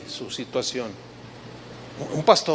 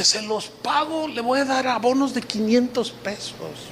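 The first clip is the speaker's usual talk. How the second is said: eager promise; 5 semitones higher, 4 dB quieter than usual. Retold or imitated. Debtor promising to repay.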